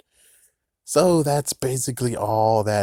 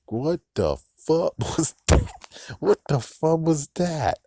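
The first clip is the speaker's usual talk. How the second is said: stunned disbelief; 8 semitones higher than usual. Retold or imitated. Reacting to the creepy commercial, high stunned outburst. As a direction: blurt it out, half laughing in shock.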